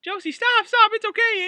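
high, very loud desperate cry to stop. Acted. Amy crying out to Josie to stop spraying Roy. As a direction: yell to call her friend off. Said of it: "Amy cried".

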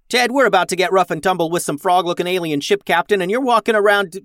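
high, loud scolding; rising, indignant captain's yell; exasperated yell. A narrator's reading. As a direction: rant at a dithering crewmate. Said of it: "Max yelled".

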